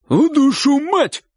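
high, drawn-out oath; warning cry. A narrator's reading. Uncle turning on the crowd, high warning shout. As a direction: shout the curse slowly as a warning. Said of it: "он его произнёс предупредительно".

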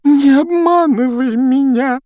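high, loud, slow sobbing ghost voice; weepy ghost girl wailing; tearful plea. Acted. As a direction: breathe it out through tears. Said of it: "выдохнула Миртл, заливаясь слезами".

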